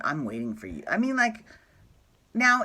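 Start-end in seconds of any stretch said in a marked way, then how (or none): 0.0-0.8 lower, creepy lurking voice; hint of a creepy stalker; creepy admirer. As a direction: play a creepy admirer, voice dropped low. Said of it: "Like someone".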